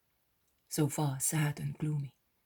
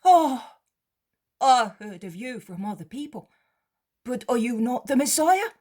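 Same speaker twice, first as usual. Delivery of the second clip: high, loud amazed cry; a beggar realising who stands before him; beggar, astonished; 8 semitones higher, 9 dB louder than usual. Acted. Beggar recognises the Messiah.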